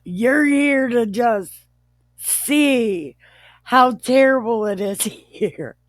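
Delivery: high, loud accusation breaking into laughter; mocking accusation. Joe accusing his brothers of spying, mocking. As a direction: accuse them with hammed-up force, cracking up.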